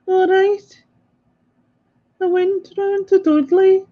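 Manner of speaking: high-pitched attempt at Brummie; Brummie accent. Having a go at Susan's accent, in high mock Brummie. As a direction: put on a high Birmingham accent. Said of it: "we've got Brummie accent from Susan".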